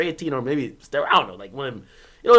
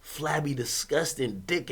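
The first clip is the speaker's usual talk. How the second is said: slow, softer disgusted hush; 5 semitones lower, 13 dB quieter, slower than usual. Real, not acted. Describing what he saw, slow disgusted hush. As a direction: drag it out in disgust.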